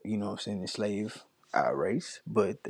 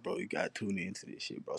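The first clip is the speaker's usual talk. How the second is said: ordinary talk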